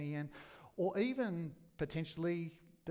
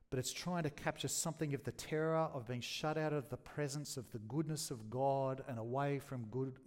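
usual speaking voice

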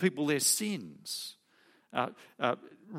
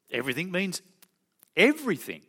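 second "everything" higher and louder; mock insistence; 4 semitones higher, 4 dB louder, faster than usual. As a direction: parody the naive reading, punching the second "everything". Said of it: "if you just take it superficially".

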